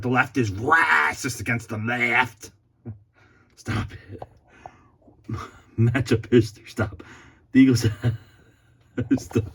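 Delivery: breaking up while telling himself to stop; laughing. Real, not acted. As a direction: giggle through the words.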